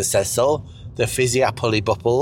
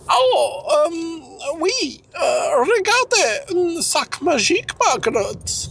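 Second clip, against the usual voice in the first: put-on high hammy French accent; mock French voice; slower than usual. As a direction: ham up a silly French lover. Mock French couple role-play.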